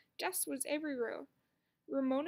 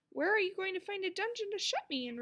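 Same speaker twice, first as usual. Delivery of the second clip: higher, louder, haughty challenge; a defiant girl playing princess, higher and louder; 6 semitones higher, 5 dB louder than usual. A narrator's reading. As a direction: toss the question back defiantly. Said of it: "Ramona was a defiant princess".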